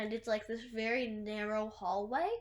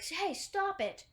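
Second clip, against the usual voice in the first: yell to stop; 9 semitones higher, slower than usual. Retold or imitated. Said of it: "she's like".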